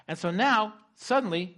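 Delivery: ordinary delivery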